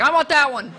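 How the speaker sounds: loud, high mock excitement; retold gleeful shout; eager outburst. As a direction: jump on it, thrilled. Retold eager choice of story.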